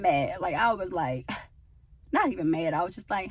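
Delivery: ordinary talk, nothing marked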